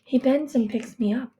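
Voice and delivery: plain reading voice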